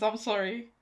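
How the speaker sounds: ordinary talk, nothing marked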